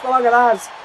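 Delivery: ordinary talk, nothing marked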